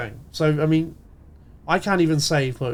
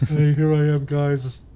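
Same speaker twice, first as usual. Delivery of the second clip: lightly imitated stoner rasp; a hint of smoker's rasp, drowsy; Moist Critical impression. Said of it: "I am ripping off Moist Critical and Meat Canyon there".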